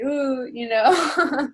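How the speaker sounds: playfully squirm at a scary thought; a long, uneasy little ooh; mock nervous. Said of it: "obviously super scary".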